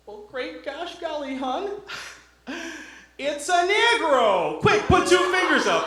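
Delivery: high, hammed-up small-town Midwesterner. Wisconsin tourist to spouse, high and hammed-up gawking. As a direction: gawk like a rube on safari.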